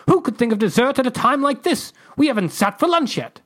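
high, loud declaration; declaring. Acted. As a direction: proclaim it, scandalised. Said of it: "the ambassador declared".

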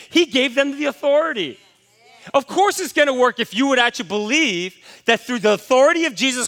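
full-voiced revival preacher; preaching at full force. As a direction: drive it home to the congregation.

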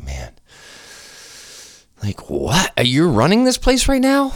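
high-pitched, exaggerated bafflement; mock incredulous. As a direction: voice leaps up high in disbelief. Retold disbelief at the store clerk.

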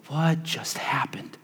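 slow, breathy bewildered whisper; stunned whisper. Retold or imitated. Disciples after the vision vanishes, dazed whisper.

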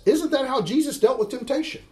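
usual speaking voice

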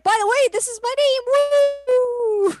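high, loud, fast boastful cheer; mock gleeful thief. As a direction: crow your name like a gleeful thief. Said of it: "It's like".

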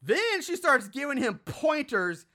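ordinary talk, nothing marked

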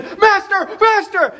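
high-pitched acted cry of alarm; panicked yelling. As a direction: yell out as if about to die. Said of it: "they're just yelling out".

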